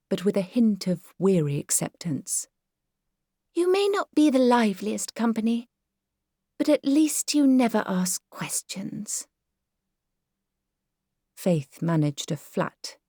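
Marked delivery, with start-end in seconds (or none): 3.5-9.3 higher, wearily affectionate praise; lightly voiced fond mother; fond Myrtle voice. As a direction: pat her daughter on the head with words. Myrtle's backhanded compliment.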